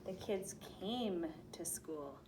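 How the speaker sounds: slow, deliberate reading. Shown as a demonstration. Teacher reading the past tense sentence slowly. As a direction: read it slowly and clearly. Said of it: "to came".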